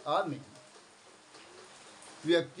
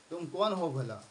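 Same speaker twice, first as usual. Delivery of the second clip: ordinary talk, nothing marked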